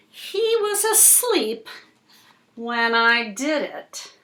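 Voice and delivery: slow, dramatic picture-book reading; reading to children. Acted. Reading the fish story to Cooper, slow and dramatic. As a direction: draw the little listener into the story.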